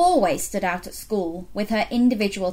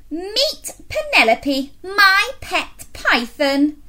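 put-on little girl voice; 9 semitones higher, 3 dB louder, slower than usual. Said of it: "announced Miranda".

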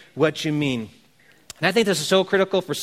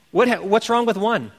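normal talking voice

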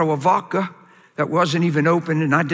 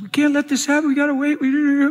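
high-pitched pleading, imitated; imitated tearful girlfriend; 9 semitones higher than usual. Fiancée begging him to wait, imitated high tearful plea. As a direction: plead in a higher voice, mimicking her upset. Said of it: "I made her cry a lot".

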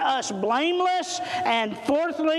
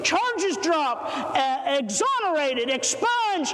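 rising, pitched-up list with a shouted peak; fervent preacher building to a shout; preaching climax; 3 semitones higher than usual. Acted. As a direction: drive the list upward like a closing altar call.

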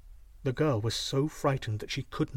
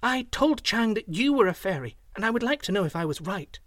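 put-on high-pitched, keen little girl; a touch of eagerness, pitched up; eager; 7 semitones higher than usual. A narrator's reading. Slave girl questions the young man, eager. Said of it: "she replied eagerly".

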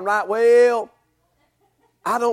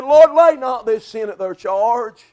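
slow, louder dying prayer; Stephen's prayer; 6 dB louder than usual. Acted. Preacher voicing Stephen being stoned, slow loud prayer. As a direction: lift it up slowly as a last plea.

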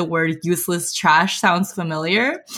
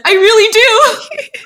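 giddy delight; 13 semitones higher, 7 dB louder, slower than usual. Acted. Giddy weapon lover answering.

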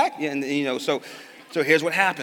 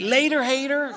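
put-on high, louder taunting child; cocky kid; 10 semitones higher, 6 dB louder than usual. Son taunting dad while speeding off, cocky. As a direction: toss it off like a cheeky four-year-old. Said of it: "He's like".

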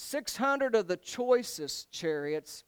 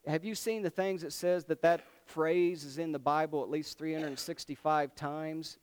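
usual speaking voice

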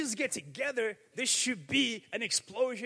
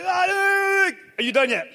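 slow drawn-out God voice; 7 semitones higher, 7 dB louder, much slower than usual. Retold or imitated. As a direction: stretch "Are you done yet?" out slowly, a bit higher. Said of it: "Go look in the Bible".

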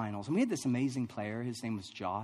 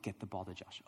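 low, hushed imitation of a coach; soft, serious coach aside; quiet reminder; 7 semitones lower, 12 dB quieter than usual. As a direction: drop right down for a last private instruction. Coach's last reminder before play.

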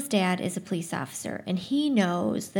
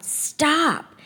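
shouting; 7 semitones higher, 3 dB louder than usual. A narrator's reading. Older man stepping in front.

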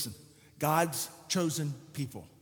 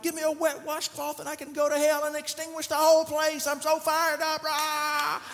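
pumped, higher, hammed-up bravado; mock fired-up; 10 semitones higher, 4 dB louder than usual. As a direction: play the overhyped believer. Fired-up believer mocked.

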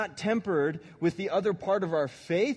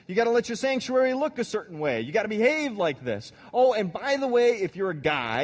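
higher, louder, eager know-it-alls; pushy rule-givers; 4 semitones higher, 3 dB louder than usual. Retold or imitated. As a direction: rattle off the rules with mock cheer.

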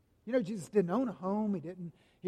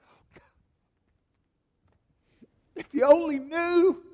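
weeping lament; 9 semitones higher, 10 dB louder than usual. Acted. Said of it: "he starts to cry".

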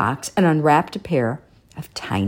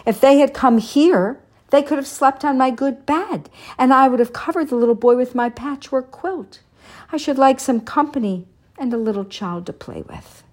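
put-on higher old man's voice, wistful; old shoemaker voicing his wish; 10 semitones higher than usual. A narrator's reading. Old shoemaker alone at Christmas, higher wistful voice. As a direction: lift the voice into a wishful old man. Papa Panov wishes aloud.

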